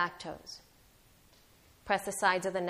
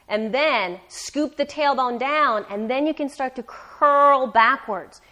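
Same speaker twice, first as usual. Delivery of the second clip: louder coaching cue; 4 semitones higher, 9 dB louder, slower than usual. Real, not acted. Instructor pushing through the hold.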